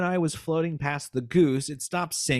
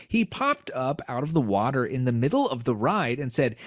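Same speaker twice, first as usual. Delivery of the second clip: ordinary talk, nothing marked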